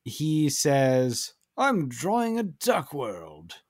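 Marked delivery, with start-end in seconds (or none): 1.6-3.5 slow, higher-pitched put-on voice; drawn-out comic quote in an assumed voice; Jonathan Banks. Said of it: "and then Jonathan Banks, ... He says".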